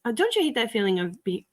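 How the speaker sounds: ordinary delivery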